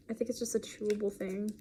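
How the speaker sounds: ordinary talk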